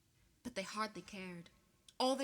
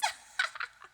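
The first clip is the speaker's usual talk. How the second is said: breathy cackle; 5 dB louder than usual. A narrator's reading. Peeves cackles.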